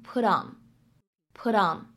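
"put on" linked with a flapped t, like "pudon". Shown as a demonstration. Teacher demonstrates the flap t in "put on". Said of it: "可以直接读成".

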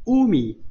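乌米 with 乌 hit high and loud, then falling; 乌米 with a falling accent on 乌; stress on first syllable. Shown as a demonstration. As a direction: punch 乌 in 乌米 and let 米 drop down. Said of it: "第一个读乌啊，重读".

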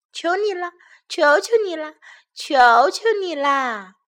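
high, insistent cub pleading; begging. A narrator's reading. Baby bear pestering parents for a chair, high pleading. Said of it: "熊宝宝央求地说".

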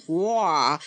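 low beastly grunt; big creature heaving with a grunt. A narrator's reading. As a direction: push out a low, effortful "bwah". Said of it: "just as he grunted".

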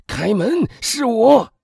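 loud, high, desperate cry at a door; anguished shouting plea. A narrator's reading. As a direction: beg at full voice to be let in. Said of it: "他的口气让人听上去多么痛苦". (痛苦地大声哀求)

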